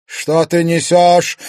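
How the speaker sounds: loud, high, drawn-out retort; indignant disbelief. A narrator's reading. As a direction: throw the question back angrily. Disbelieving retort to shocking news.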